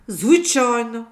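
slow, loud assuring word; reassuring. Acted. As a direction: promise him it's true. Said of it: "запевнив її хлопчик". (впевнено й повільно)

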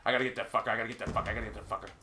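low, very soft self-talk; intent muttering. Real, not acted. Gamer hunting an enemy, muttering to himself. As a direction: repeat it under your breath, sinking further.

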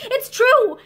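high, loud, emphatic insistence; desperate. A narrator's reading. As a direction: insist desperately, leaning on each phrase. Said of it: "she went on desperately".

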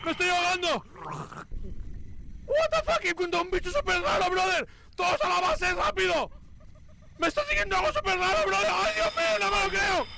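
high, shrill-pitched panicked yelling. Real, not acted. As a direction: scream for the team, terrified. Monster chasing him in game.

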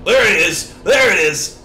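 loud, high, punched exclamation; hammed-up excited holler; gleeful spotting. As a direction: crow it twice in mock triumph. Spots the leg in game.

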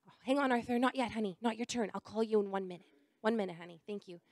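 hushed, kindly "not yet"; quiet aside. Real, not acted. Hushed aside to young son.

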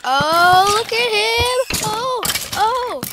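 loud happy taunting; gleeful gloating. Real, not acted. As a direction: crow over the beaten monsters. Gloating as monsters die.

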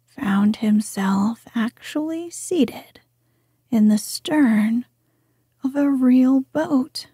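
plain reading voice

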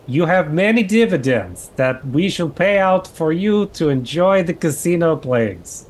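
a hammy French Canadian gentleman; French Canadian accent. Acted. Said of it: "Is this Mister Chapek's French Canadian accent?".

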